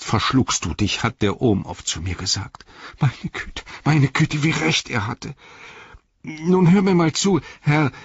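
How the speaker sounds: high, agitated servant's plea; flustered, plucky Sam. Acted. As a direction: scold yourself, then stand up to a lord.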